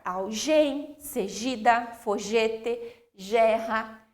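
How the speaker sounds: algem, segida, fogete, gerra with G as in "gelo"; deliberately wrong zh-sound G in algem and segida; soft G misreading. Shown as a demonstration. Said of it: "se eu não colocar o U".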